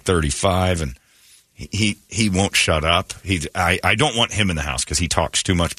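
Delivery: ordinary talk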